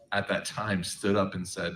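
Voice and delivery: ordinary talk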